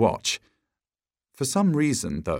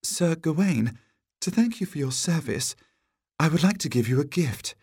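high-pitched damsel voice; damsel, formally grateful; 7 semitones higher than usual. A narrator's reading. Damsel rewarding the knight for his service, high and formal. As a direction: offer a gift in a high damsel voice.

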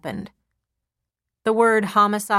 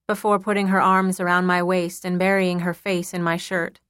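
usual speaking voice

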